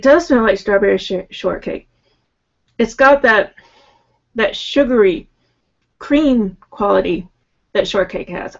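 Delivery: speaking into bottle neck; bottle at mouth. Real, not acted. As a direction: lips against bottle opening. Seen 0: Bottle neck pressed at lips while sniffing and talking, +1.3 to +13.5.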